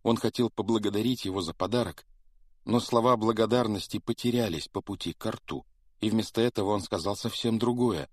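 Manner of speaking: ordinary delivery, nothing marked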